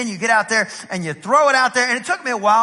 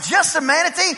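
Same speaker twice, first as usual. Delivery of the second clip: higher-pitched indignant disbelief; incredulous echo; 7 semitones higher than usual. Retold or imitated. East Texan answering onlookers, incredulous and higher. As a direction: throw JUST A MANATEE back as a question.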